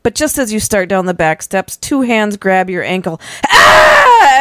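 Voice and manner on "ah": loud, very high scream; cry out. A narrator's reading. Child grabbed by the ankle on the steps, loud scream. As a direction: shriek as if suddenly tripped. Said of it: "as you cry as you trip".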